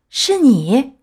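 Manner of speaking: high, startled question; astonished question. A narrator's reading. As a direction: blurt it out in disbelief. Buyer astonished at the puppet. (惊讶地高声反问)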